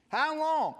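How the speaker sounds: loud, high, impatient cry of the crowd; impatient demand. Retold or imitated. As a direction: shout it up at him, pressing for an answer.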